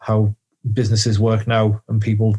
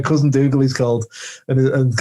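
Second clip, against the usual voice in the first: usual speaking voice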